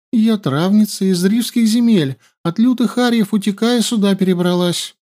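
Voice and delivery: high, eager-to-please reply; meek girl. A narrator's reading. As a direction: answer humbly and fast. Captive answers meekly. (заискивающая травница)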